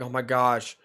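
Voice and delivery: low, trailing off softly; sheepish imitation, repeated under your breath; mock apology. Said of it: "Okay, so he was like".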